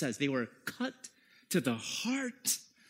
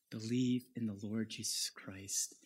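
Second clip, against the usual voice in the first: usual speaking voice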